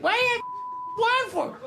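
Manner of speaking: high-pitched screech of a wronged wife; outraged duck wife. Acted. Duck wife confronting her cheating husband, high outraged screech. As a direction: shriek the question in a very high voice. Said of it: "His wife's like".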